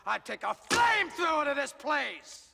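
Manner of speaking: loud, high roar of threat; explosive, full-force outburst; furious shout. Acted. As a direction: bellow it in rage. Enraged threat at the hearing.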